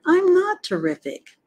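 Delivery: high, plaintive objection; protesting pig. A narrator's reading. Pig objecting to the spider's praise, high protest.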